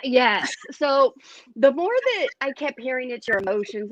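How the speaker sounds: ordinary talk, nothing marked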